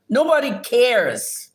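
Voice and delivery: high, drawn-out, angry dismissal; scornful dismissal. Real, not acted. Streamer dismisses attention-seeking commenters, scornful. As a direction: stretch it out slowly with contempt.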